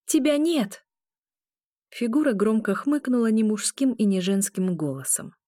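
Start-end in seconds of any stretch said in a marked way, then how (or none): 0.1-0.8 high, emphatic denial; insistent, high-pitched rebuff; defiant command. A narrator's reading. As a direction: defy the phantom with pitch raised. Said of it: "приказала я".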